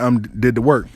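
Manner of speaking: ordinary delivery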